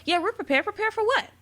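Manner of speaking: high-pitched, lightly voiced joke exchange; mock hyena banter. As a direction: lightly act out comic sidekicks, pitch jumping up.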